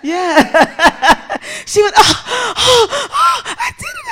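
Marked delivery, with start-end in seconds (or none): 2.0-4.1 put-on high, gasping child's cries; loud, squeaky sobbed relief; mock sobbing. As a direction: imitate a little girl gasping through tears. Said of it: "she started to cry".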